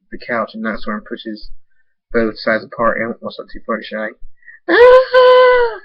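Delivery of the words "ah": a high, loud shriek of terror; scream. Acted. Fluttershy attacked by Nightstorm, shrieking loud and high. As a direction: let out a piercing scream. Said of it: "she screams".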